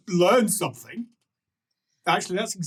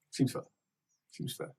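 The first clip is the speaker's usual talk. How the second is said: very soft, clipped-short twin echo; muted agreement; 12 dB quieter than usual. Acted. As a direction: concede it under your breath. Twins agree in turn.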